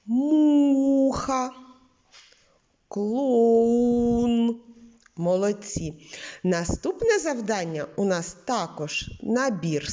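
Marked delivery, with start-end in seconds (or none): 0.1-4.6 у sung out long and higher in муха and клоун; муха, клоун with у stretched; intoning U. Shown as a demonstration. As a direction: intone the у in each word. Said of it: "інтонуючи звук У".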